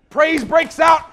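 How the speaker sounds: normal talking voice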